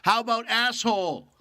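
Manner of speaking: a loud jeering call through the studio glass; heckle through glass. Retold or imitated. A guy behind the glass heckles the radio DJ audition, jeering call. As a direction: yell it as a heckle from the booth. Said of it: "I hear him through the glass go".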